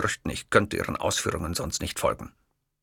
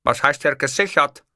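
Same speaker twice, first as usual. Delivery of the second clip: irritated, higher and louder dictator voice; annoyed; 5 semitones higher, 5 dB louder than usual. Acted. Hitler, annoyed by the clerk, snapping. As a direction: snap back in annoyance as the Führer. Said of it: "fragte ich genervt".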